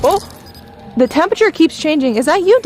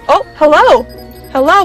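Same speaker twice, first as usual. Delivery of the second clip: a sudden, louder hello; startled hello; 3 semitones higher, 4 dB louder than usual. Real, not acted. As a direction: caught off guard. Device suddenly reacts mid-sentence.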